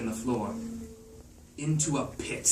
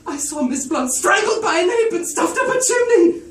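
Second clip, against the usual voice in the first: hysterical scream; 12 dB louder than usual. Acted. Miss Pope reporting the murder, a hysterical shriek. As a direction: shriek in horror, near tears. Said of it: "A woman screamed".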